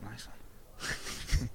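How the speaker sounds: sarcastic whispered aside; whispered thought. Retold or imitated. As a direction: breathe it out as a dry inner thought. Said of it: "I thought".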